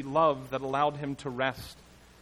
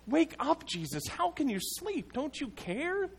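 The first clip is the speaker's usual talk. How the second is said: much higher, urgent cry to wake him; desperate plea; 12 semitones higher than usual. Acted. Preacher voicing a desperate believer, pleading with Jesus. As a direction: shake him awake with your voice.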